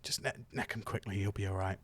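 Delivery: soft whispered aside; Zeed whispering to his dad; secretive whisper. Acted. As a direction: slip the potions over quietly. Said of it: "gives them to you in your cloak and goes".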